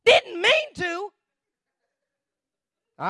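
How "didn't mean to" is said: loud, high, drawn-out question; stretched, scoffing yell; incredulous echo. Acted. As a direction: throw it back in disbelief.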